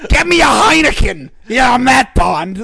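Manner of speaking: shouted hammy spy order; loud mock Bond. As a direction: bark the order like a cocky spy. Mock Bond product placement.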